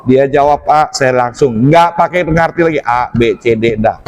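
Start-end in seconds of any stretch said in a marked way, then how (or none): none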